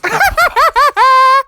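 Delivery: high, loud rapid-fire crazy laugh; mad cackle. Real, not acted. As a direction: burst into a manic, high cackle. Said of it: "I laugh like that".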